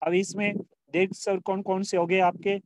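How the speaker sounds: ordinary talk, nothing marked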